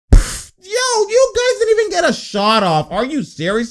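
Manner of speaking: squeaky, incredulous outburst; high-pitched disbelief. Real, not acted. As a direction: voice shoots way up in exasperation. Disbelief at team's failed possession.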